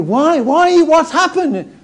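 nosy neighbours pressing; curious mourners. Acted. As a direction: mourners demanding to know what happened. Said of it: "they say to her".

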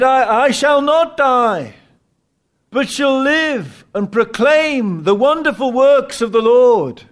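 high, loud, slow proclamation; shouted out. Retold or imitated. Dying Wycliffe to his enemies, proclaiming loudly. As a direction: cry it out in faith. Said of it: "he shouted out".

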